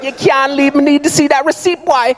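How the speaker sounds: put-on high-pitched Jamaican accent; Jamaican security guard. Guard stops a customer leaving without receipt, high Jamaican accent. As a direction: order them about in high Jamaican patois. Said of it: "the Jamaican security guard".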